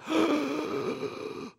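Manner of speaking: high sudden intake of breath; sharp gasp of recognition. Retold or imitated. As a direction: gasp as it dawns on you. Disciples recognize Jesus.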